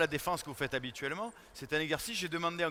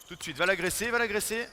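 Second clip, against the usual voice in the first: urgent shout; 4 semitones higher, 6 dB louder than usual. Real, not acted. Coach urging defender during drill.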